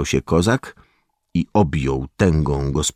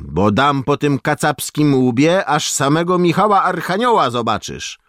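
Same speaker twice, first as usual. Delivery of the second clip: higher, louder angry peasant-woman yell; irritated yell; 11 semitones higher, 4 dB louder than usual. A narrator's reading. Peasant woman chasing off a pushy soldier, irritated yell. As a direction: shoo the soldier off, yelling. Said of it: "wrzasnęła poirytowana".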